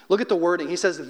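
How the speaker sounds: ordinary talk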